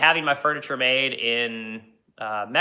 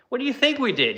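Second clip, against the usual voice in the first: incredulous; 11 semitones higher than usual. Retold or imitated. Incredulous retort to customer.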